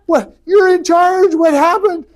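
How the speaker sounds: hammed-up disbelieving yell; outraged Moses. Moses confronts Aaron, mock outraged shout. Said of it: "He's like".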